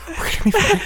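horror movie voice. Retold or imitated. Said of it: "The way you said".

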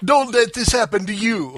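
high, hammed-up insurance pitch; mock ad warning. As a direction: warn the viewer like a TV sponsor spot. Acting the insurance sponsor pitch.

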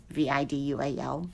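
"V I D U A L" said letter by letter, fast and soft; spelled out. Shown as a demonstration. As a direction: spell "V I D U A L" one letter at a time.

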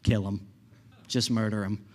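a hint of a stern God; deadpan God voice. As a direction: drop low and flat for God's order. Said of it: "And God's like".

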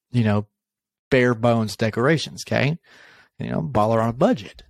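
usual speaking voice

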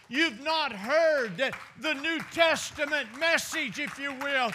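high, forceful rebuke in a preacher's cadence; preached yelling. Retold or imitated. Preacher as Jesus clearing the temple, preached yelling. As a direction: drive it at the crowd as a scolding. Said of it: "he yells at 'em and he says".

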